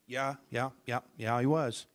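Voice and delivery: ordinary delivery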